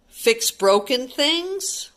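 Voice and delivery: ordinary delivery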